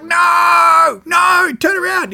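high frantic shout, "turn around" stretched out slow; panicked yell. Retold or imitated. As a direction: yell at the skipper to turn the boat back. Panicking as tuna swim away.

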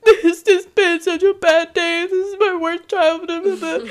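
high, loud hammed-up whine; mock wailing. Retelling her call to mom in a hammed-up wail. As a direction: wail it like a self-pitying kid.

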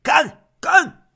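high, loud drinking shouts; two separate shouts of a toast; 喊干杯. Acted. 酒桌上众人应声干杯. (高声喊干杯)

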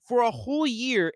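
high, loud burst of fan excitement; thrilled outburst. Real, not acted. Reviewer telling viewers the theater lost it, excited. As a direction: let the thrill spill over. Packed theater losing their minds.

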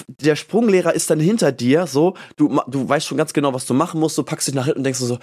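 ordinary talk, nothing marked

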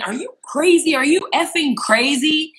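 high, outraged mock scream; retold screaming. Retelling her screaming back at the men, high outrage. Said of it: "So I started screaming".

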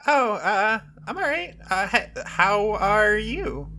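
shy character voice. Acted. Character voice for Catalina.

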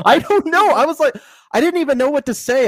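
squeaky stunned voice; high-pitched disbelief. Real, not acted. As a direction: lift into a baffled squeak. Shocked by turtle stealing.

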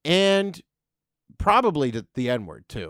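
usual speaking voice

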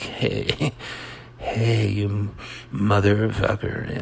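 laid-back, easygoing cursing with a little laugh; mellow mock-melodramatic. Comic contrasting it with dramatic, mellow. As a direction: swear at them as if totally chilled. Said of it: "melodramatic is like".

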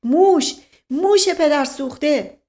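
high, loud cry of alarm; alarmed yell. A narrator's reading. Man faking a mouse scare, yelling. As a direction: yell out as if spotting vermin. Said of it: "فریاد کشید".

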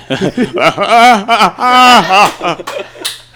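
long, loud, high laughing fit; his famous laugh. Shown as a demonstration. Showing friends the laugh that earned him money, loud and high. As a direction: let out the big signature laugh. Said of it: "they would laugh at my laugh".

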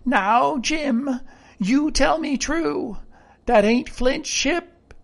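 suspicious castaway warning; threatening. A narrator's reading. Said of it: "threateningly before my eyes".

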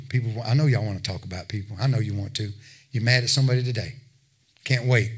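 normal talking voice

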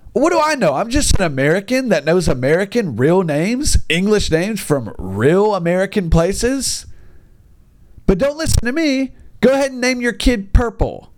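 high, sarcastic don't listen to me; frustrated teacher mocking parents, high and sarcastic; sarcastic exasperation.

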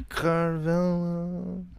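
slow, soft, high "Carvello"; drawn-out name. Pretend. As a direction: stretch the name out long and quiet. Repeats the garbled name, drawn out.

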